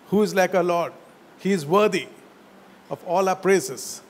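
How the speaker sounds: ordinary delivery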